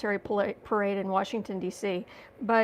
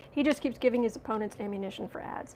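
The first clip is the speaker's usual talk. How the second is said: ordinary delivery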